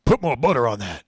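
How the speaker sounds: loud rude order from a big guy; gruff demand. Retold or imitated. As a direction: bark it at the counter clerk. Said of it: "and he goes".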